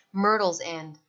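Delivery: usual speaking voice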